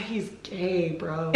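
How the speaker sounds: soft, deadpan retort; understated told-you-so; flat deadpan. Retold or imitated. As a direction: drop it quietly.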